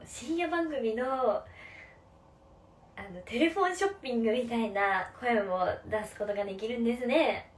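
late-night TV sales pitch, loud and pushy; a hint of an infomercial host; teleshopping voice. Shown as a demonstration. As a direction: sell it like a midnight infomercial host.

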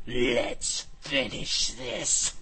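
very slow menacing growl; deep, scary voice. A narrator's reading. Enraged hero turning on the villain, deep scary voice. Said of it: "said in a deep, scary voice".

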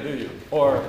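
usual speaking voice

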